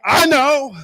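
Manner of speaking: high, loud breezy reply; confident girl. Retold or imitated. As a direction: toss it off with easy confidence. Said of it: "then I'd be like".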